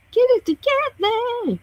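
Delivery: squeaky mock exasperation; exasperated high plea. Real, not acted. Urging a slow phone.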